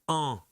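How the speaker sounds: "en" as one isolated French nasal vowel; demonstrated en sound. Shown as a demonstration. Teacher showing the sounds to distinguish, "en" as a nasal vowel. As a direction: say "en" nasally, with no n consonant. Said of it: "vous allez distinguer le".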